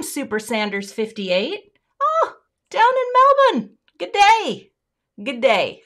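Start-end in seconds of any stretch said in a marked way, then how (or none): 2.0-5.8 loud, hammed-up Australian greeting; bad Aussie accent. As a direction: call it out in a badly faked Aussie accent. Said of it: "I cannot do an Aussie accent".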